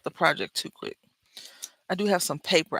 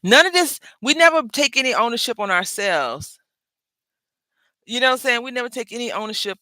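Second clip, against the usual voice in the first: heated rant; 6 semitones higher, 6 dB louder than usual. Real, not acted. Venting about blaming others.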